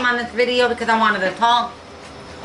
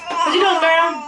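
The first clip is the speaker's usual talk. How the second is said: higher, playful pet voice; pet talk; 6 semitones higher than usual. Real, not acted. To her chihuahua Candy, higher pet talk. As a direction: tease the dog with a lilt.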